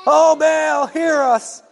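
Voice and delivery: high desperate shout to Baal; shouting prophets. Acted. Prophets shouting to Baal, desperate high shout. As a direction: cry out to an idol that won't answer.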